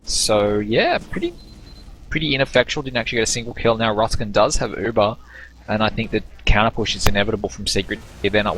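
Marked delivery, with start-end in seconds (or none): none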